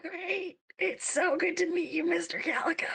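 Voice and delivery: high, squeezed greeting through mock pain; be polite while your hand is being crushed; pained politeness. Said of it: "holding onto her hand in pain".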